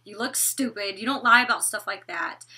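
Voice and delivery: ordinary talk, nothing marked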